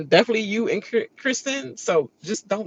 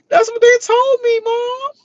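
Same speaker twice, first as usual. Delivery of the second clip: much higher, louder, breaking into laughter; laughing; 5 dB louder than usual. Real, not acted. Quoting him to her mom, squealing with laughter.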